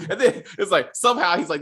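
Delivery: usual speaking voice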